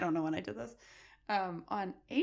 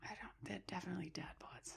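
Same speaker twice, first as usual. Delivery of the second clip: near-whispered, very soft; hushed aside; 20 dB quieter than usual. Real, not acted. Host correcting co-host on curlers' bodies, hushed remark.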